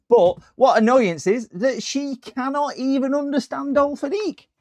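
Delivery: high-pitched, exasperated rant; mock indignation. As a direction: complain in hammed-up, squeaky outrage. Mock outrage at caller not understanding.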